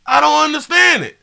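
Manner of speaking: high, loud excited rant; loud high-pitched worked-up ramble. Real, not acted. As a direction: get carried away and yell high.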